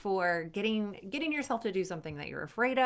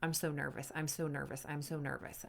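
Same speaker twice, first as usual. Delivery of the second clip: low, soft, understated mantra; repeated nervous thought; 5 semitones lower, 7 dB quieter than usual. Acted. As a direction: recite the worry quietly under your voice. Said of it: "tasks while thinking".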